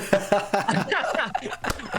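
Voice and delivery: high bursting laugh. Real, not acted. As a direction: laugh at your own bit. Laugh after mock emotion.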